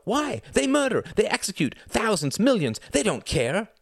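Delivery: very high, agitated accusations; shrill. A narrator's reading. Ruth condemning the regime to Reginald, shrill. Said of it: "her voice shrill".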